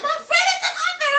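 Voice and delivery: high, loud shout of pure joy; friend's excited shout. Retold or imitated. Friend yelling about the author's book, excited shout. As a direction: yell it like your best friend just hit it big. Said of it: "she's like".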